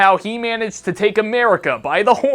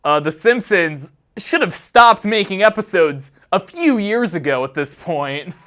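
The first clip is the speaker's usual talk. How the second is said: ordinary delivery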